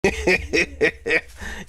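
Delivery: short amused laugh; a quick real chuckle at the joke. Real, not acted. Streamer laughs at bald Trump idea.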